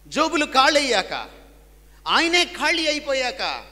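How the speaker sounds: loud, high, slow building sermon climax; full-force preaching. Acted. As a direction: preach it out with rising fervour. Sermon peak.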